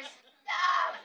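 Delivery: mock shout of the trapped kid; mock kid's shout; kid shouting stop. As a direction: yell it like a squeezed kid. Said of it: "And I hear".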